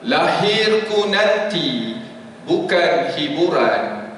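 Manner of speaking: slow, solemn poem reading; poem recital. Acted. Preacher closing his talk with a slow, solemn poem. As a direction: let each line sink in slowly.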